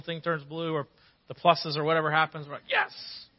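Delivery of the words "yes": a much higher, thrilled little yes; excited yes. Retold or imitated. Couple seeing a positive test, high thrilled yes. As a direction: squeal it with delight.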